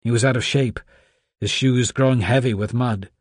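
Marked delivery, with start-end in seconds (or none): none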